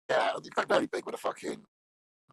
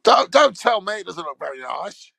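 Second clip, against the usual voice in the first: loud, higher, indignant yell; mock angry shout; 9 semitones higher, 10 dB louder than usual. Shouting back at his own played-back recording, mock angry. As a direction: bellow it back in mock outrage.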